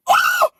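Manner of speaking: very high, loud yelped "oh"; shocked shriek. Real, not acted. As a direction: shriek in disbelief. Shrieks at the reply she read.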